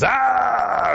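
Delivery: high, loud "ah" scream; acted yelp of pain, held long. A narrator's reading. Rabbit ripping fur off his own belly, high pained scream. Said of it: "he screams".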